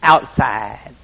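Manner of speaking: lightly voiced old farmer; Wood's blunt answer. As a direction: say it like it's obvious. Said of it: "he talked like this".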